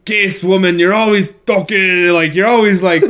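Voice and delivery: botched Scottish drifting into Jamaican pirate; mock Scottish-Caribbean accent, scolding a wife; bad Scottish accent. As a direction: attempt Scottish, land on weird Caribbean pirate. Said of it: "weird, like, Jamaican, Caribbean pirate".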